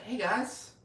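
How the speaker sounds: high, lilting retold hello; mock friendly greeting. Homelander arriving with ice cream cake, mock friendly greeting.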